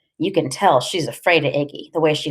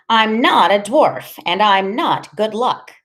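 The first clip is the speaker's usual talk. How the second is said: raised, slow, emphatic denial; offended, deliberate and higher-voiced; indignant protest; 5 semitones higher, 4 dB louder, slower than usual. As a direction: push back hard, stressing each word. Freak protests being rubbed for luck.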